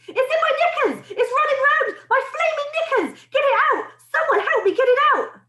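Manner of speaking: very high, frantic yelling; screaming. A narrator's reading. Cook begging mates to remove the mouse, screaming. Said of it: "the man was screaming".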